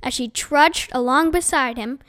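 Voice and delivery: plain reading voice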